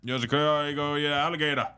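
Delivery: high, loud, fast mock bayou accent; Louisiana accent. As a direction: rattle it off like a Cajun. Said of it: "Louisiana's like".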